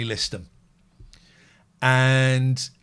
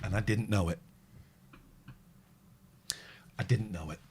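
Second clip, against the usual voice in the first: emotional; 7 semitones lower, 12 dB quieter, faster than usual. Real, not acted. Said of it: "I'm quite emotional at that".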